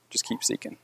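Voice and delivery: soft, breathy whisper of encouragement; whispered repeat of the plea. Real, not acted. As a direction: drop to a whisper as you repeat it. Urging the man to keep seeking.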